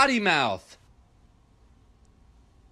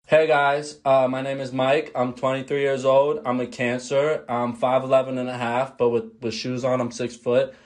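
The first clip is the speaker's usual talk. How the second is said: dating intro; 7 semitones lower than usual. Pretend. Dating profile parody, low voice.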